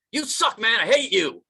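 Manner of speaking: loud, high mock tantrum; petulant outburst. Guy to friend who won't help him move, petulant shout. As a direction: yell it like a spurned buddy.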